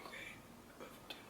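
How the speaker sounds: barely audible voiceless whisper. Retold or imitated. Ashley whispering back to Kaylin, barely audible whisper. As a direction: breathe it out, giving in.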